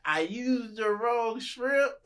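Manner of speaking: high, slow whiny excuse; mock excuse. Teasing the host about her failed pizza, whiny. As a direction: whine it like a flop. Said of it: "You gonna be".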